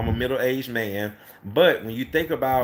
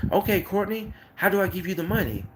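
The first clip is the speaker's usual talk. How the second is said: higher and fast, rushing to pay; eager customer; 7 semitones higher than usual. Retold or imitated. A cleaner rushing to pay Courtney, excited, higher and fast. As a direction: hurry him to hand over cash.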